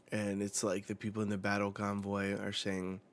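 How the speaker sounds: usual speaking voice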